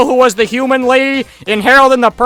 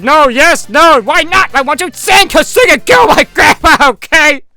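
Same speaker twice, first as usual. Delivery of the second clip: loud, raised-pitch argument; mock heated outburst; 3 semitones higher, 6 dB louder than usual. Troll confessing why he won't sing, heated outburst. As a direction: blurt it out in hammed-up exasperation. Acted quarrel over singing.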